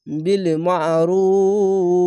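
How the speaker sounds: বিল মারুফ very slowly, রু stretched three beats, final ফ stopped; long drawn stop on মারুফ; madd arzi stop. Shown as a demonstration. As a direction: stretch and stop at the verse end. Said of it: "তিন আলিফ টেনে পড়বেন".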